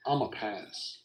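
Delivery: soft, low, unbothered; cool confidence. Retold or imitated. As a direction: shrug it off coolly. Cocky calm repeat.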